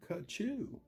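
plain reading voice

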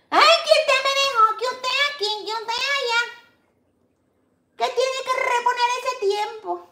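high, sing-song mocking whine; high nagging mimicry of a complainer; mocking whiny complainer. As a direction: squeak it like a spoiled kid whining to grandma.

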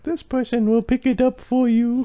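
squeaky high loud voice; high put-on voice. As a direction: play a silly stranger. Voicing imagined owner.

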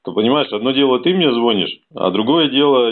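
ordinary delivery

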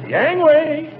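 high, loud warning shout; sharp high call of warning; shouting gangway. Acted. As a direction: yell it to clear the road. Driver warning people out of way.

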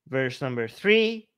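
normal talking voice

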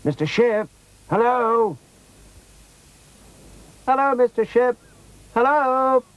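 put-on high child's voice hailing someone; calling out. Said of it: "called Rosie".

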